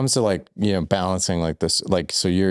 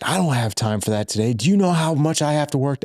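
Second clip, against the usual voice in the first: retold dad snapping, voice climbing much higher on the question; a retold father brushing his son off, going sharply higher; angry dad; 8 semitones higher than usual. Retelling a dad refusing his eleven-year-old, irritated and rising high.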